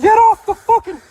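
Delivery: high loud yelled order; shouting. Real, not acted. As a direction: bark the order hard. Officer yells during struggle.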